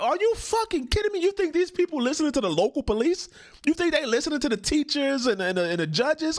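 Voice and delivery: high, disbelieving outburst; can't believe what he just heard; incredulous. Real, not acted. Disbelief at the clip.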